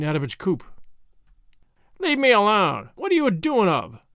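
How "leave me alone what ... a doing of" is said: screeching. A narrator's reading. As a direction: screech in outraged protest. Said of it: "she screeched".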